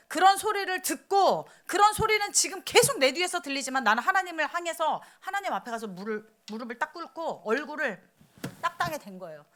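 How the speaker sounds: ordinary delivery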